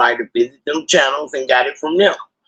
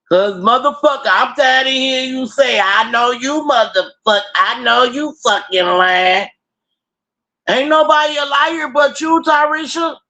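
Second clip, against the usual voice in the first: retold fed-up rant, pitched well up; exasperated retort; 9 semitones higher than usual. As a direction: act out snapping back at a cousin, high-pitched. Retold quarrel with cousin on phone.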